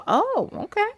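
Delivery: lightly voiced awed girl; high girl voice. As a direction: squeak it up high. Vasilia impressed by witch.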